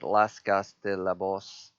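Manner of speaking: slow, clumsy attempt at a French name; a self-conscious try at French pronunciation; bad French accent. Shown as a demonstration. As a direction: stumble through it in a mock French accent. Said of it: "that sounds terrible".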